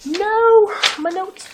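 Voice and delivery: real high, loud yelp of dismay; dismayed cry. Real, not acted. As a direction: cry out as something slips away. Her notes go astray mid-recap.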